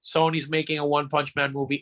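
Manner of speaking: ordinary talk, nothing marked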